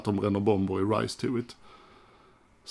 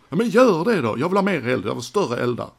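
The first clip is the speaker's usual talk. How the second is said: higher and louder demanding bark, easing off at the end; imitating a boss who wants more fire; demanding shout; 4 semitones higher, 5 dB louder than usual. Gene ordering the crew to make bigger fires, mock shouting. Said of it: "skäller han som fan".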